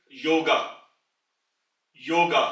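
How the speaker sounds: योगा with a y sound; German word demo. Shown as a demonstration. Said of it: "जैसे कि". (योगा का German उच्चारण)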